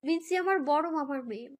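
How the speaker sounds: ordinary delivery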